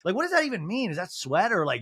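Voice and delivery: ordinary delivery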